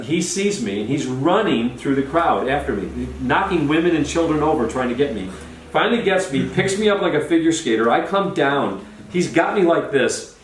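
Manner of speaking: ordinary talk, nothing marked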